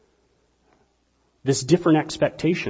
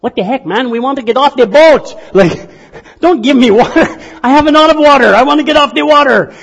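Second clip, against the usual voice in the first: much higher and louder frustrated protest; exasperated castaway; 9 semitones higher, 9 dB louder than usual. Retold or imitated. Castaway yells at the fishermen, exasperated and pleading. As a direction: complain loudly that water misses the point.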